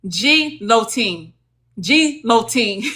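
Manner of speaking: put-on "G-lotine" said as JEE, then LO-TEEN; deliberately wrong, spaced-out "G-lotine"; joking mispronunciation. Shown as a demonstration. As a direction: split "G-lotine" after the letter G, playfully. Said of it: "I be messing with y'all".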